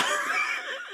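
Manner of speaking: a sudden high squeal of laughter; real shocked laugh, way up high; shrieking laugh. Real, not acted. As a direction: burst out in a shrill cackle. Reacting to Rock touching Cody.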